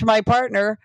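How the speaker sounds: ordinary delivery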